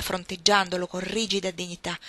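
ordinary delivery

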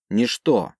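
plain reading voice

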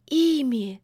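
high, alarmed, horrified questions; horrified hiss. Acted. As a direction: recoil in sudden terror. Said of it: "в ужасе прошипел Баюн".

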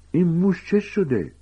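very high, slow anxious question; frightened. Acted. Ron clutches his pet rat, frightened and high-pitched. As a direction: ask it in a high, scared voice. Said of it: "رون که ترسیده بود".

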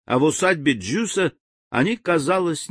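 ordinary delivery, nothing marked

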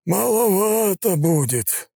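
high, very slow drawl; drawn-out drawl. A narrator's reading. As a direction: stretch it out lazily. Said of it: "протянул он".

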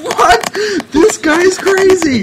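very high, loud squeal of disbelief; mock shock. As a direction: shriek it slowly in hammed-up astonishment. Retold shocked reaction to suitor.

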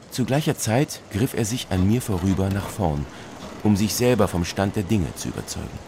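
ordinary delivery

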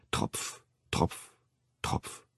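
soft, whispered drops; dripping whisper. A narrator's reading. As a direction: let each drip fall softly. Blood drops slowly.

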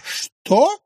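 sharp high, loud query; indignant surprise. Acted. Wife taken aback by husband's retort, indignant. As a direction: jump up in pitch, affronted. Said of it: "вскинула брови Анфиса".

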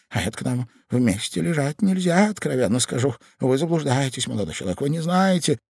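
a touch of imploring despair; pleading. A narrator's reading. Ivan begging the young man to be politer, pleading. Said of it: "голосом, в котором было слышно моление".

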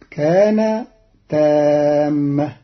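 usual speaking voice

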